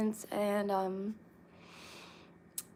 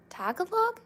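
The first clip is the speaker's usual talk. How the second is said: much higher puzzled question; surprised; 12 semitones higher, 3 dB louder than usual. Real, not acted. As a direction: ask it high, baffled. Repeats unfamiliar word, puzzled.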